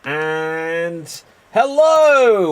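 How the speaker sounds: long stretched booming hello; big drawn-out hello. Acted. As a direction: stretch it out like a showman. Big show opening greeting.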